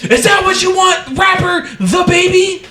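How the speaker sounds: shrieking, cornered dad at a concert; mock screaming dad. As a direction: scream it out in cartoonish outrage.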